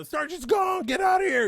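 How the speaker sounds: high, loud panicked yell; panicked shout. Retold or imitated. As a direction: scream like a terrified marine. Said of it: "you know".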